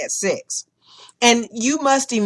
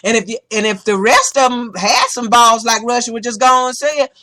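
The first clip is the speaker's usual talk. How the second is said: ordinary delivery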